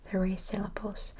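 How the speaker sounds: normal talking voice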